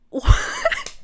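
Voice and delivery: very high squealed reaction; mock shock. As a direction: squeal it in hammed-up disbelief. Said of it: "And you're like".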